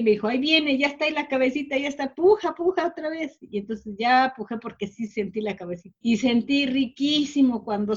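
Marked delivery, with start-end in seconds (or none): 0.3-3.4 higher, urgent coaching voice; urging push. Retold or imitated. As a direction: egg her on to push. (partera animando a pujar, voz aguda y urgente)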